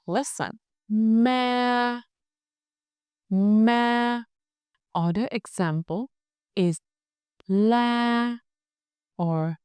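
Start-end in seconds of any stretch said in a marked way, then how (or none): 0.9-4.2 MA held high, flat and long; level tone. Shown as a demonstration. Teacher shows students thanh ngang, MA high and level. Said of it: "high and level". 7.5-8.4 LA held high and flat; level tone. Shown as a demonstration. Teacher gives another thanh ngang example, LA high and level. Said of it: "high and level".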